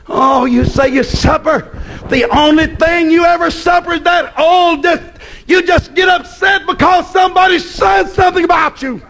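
fiery pulpit rebuke; preaching at full force. Acted. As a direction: thunder it at the congregation.

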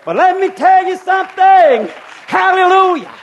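loud, high shouted preaching. Real, not acted. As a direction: build to a full shout.

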